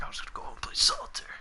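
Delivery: quick whispered grumble, imitating grandpa; mock whisper. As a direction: breathe it out fast like a muttering grandpa.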